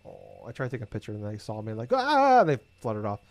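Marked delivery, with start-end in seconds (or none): 1.9-2.4 startled bird squawk. Retold or imitated. Birds startled by her camera.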